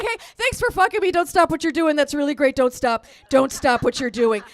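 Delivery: loud, rapid-fire praise; hammed-up cheerleading; eager encouragement. Acted over-eager encouragement to wife.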